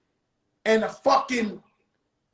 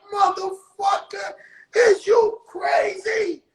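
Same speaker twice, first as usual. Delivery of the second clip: incredulous yell; 9 semitones higher than usual. Retold or imitated. Joe yelling at Pun about the worst jail, high exasperated cry. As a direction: scream at him like he's lost his mind.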